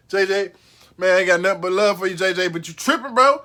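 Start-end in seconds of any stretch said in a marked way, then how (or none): none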